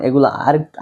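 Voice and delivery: ordinary delivery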